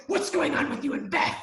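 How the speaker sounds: hear it as a threat; imitating the mother as an accuser; menacing version. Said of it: "I'm hearing".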